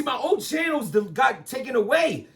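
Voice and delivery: ordinary talk, nothing marked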